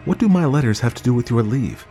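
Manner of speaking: puzzled, voice lifted; indignant question. A narrator's reading. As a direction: question him in disbelief. Wife puzzled in quarrel.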